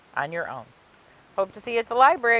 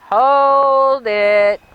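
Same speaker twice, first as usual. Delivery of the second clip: loud, higher call to stop; sudden interruption; 5 semitones higher, 9 dB louder than usual. Acted. Unseen helper stopping Frankenstein at the door, loud call. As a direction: cut the narrator off with a louder shout.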